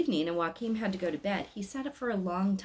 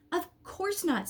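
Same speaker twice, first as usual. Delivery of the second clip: put-on a boy, sure of himself; 11 semitones higher, slower than usual. A narrator's reading. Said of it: "said Joachim".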